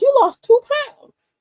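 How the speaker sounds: high, slow, loud nurse voice announcing the news. Retold or imitated. Nurse weighing him, announcing in a high slow voice. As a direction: sing out the number like good news. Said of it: "she goes".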